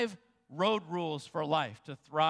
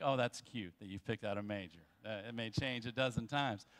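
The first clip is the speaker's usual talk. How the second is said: condescending mock aside; 9 semitones lower, 7 dB quieter than usual. Mock voice of a dismissive adult.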